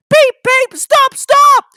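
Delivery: very high, loud shout to stop; panicked whistle. Acted. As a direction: scream for them to stop. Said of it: "he whistled".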